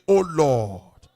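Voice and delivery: loud, slow cry to the Lord; loud prayer. Shown as a demonstration. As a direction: shout the prayer slowly. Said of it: "Shout it loud and clear".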